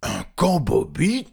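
loud, high, slow troll question; surprised trolls. Acted. Trolls hearing an unknown word, loud surprise. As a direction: echo the odd word in puzzled surprise. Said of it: "firent-ils un peu surpris".

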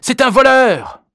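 very high, loud drawn-out cry; breathless shout. A narrator's reading. Victim denouncing a thief to bystanders, breathless shout. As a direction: shout the alarm, stretching the last word. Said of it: "haleta l'un des deux".

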